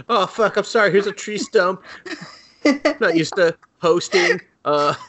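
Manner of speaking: a jittery host begging not to be killed; flustered snake. Retold or imitated. Snake nervously hosting the girl, high anxious voice.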